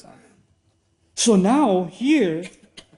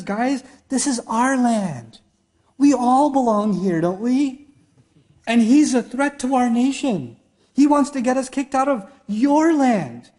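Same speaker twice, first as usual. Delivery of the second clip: a hint of a tyrant playing friendly, higher and slow at first; mock humble Pharaoh; 5 semitones higher, slower than usual. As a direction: coax the generals like their buddy. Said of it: "he comes down from his".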